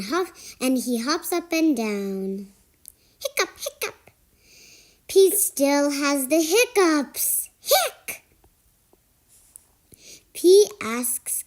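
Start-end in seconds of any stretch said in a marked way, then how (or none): 3.2-4.1 high soft hiccups. Acted. Hiccup sounds. 7.6-8.2 very high hic; high squeak hiccup. Acted. Hiccup sound.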